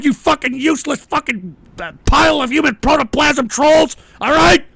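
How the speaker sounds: real anger, ending on a loud yell; angry insults. Real, not acted. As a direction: lay into the trolls. Host insults the trolls.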